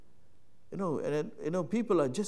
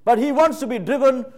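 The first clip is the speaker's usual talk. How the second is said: higher, louder emphatic sermon climax; full-force preaching; 10 semitones higher, 13 dB louder than usual. Acted. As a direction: drive the point home from the pulpit.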